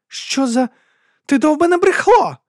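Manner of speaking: very high, outraged yell; furious denial. A narrator's reading. Woman calls the accusing duke a liar, yelling. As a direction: explode at the accuser, pitched right up. Вона заперечує звинувачення криком.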